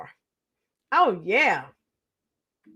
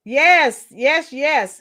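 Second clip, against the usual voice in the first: ordinary delivery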